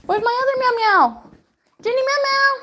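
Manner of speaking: high, loud pet-calling voice; squeaky-high doting call; calling a cat. Real, not acted.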